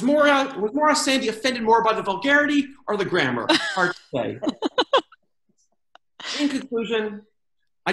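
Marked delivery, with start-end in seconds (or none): none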